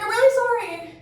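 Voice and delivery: high, pleading apology; mock-tearful high apology; sorry and freaking out. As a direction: whimper it like a scared teen. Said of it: "I'm freaking out".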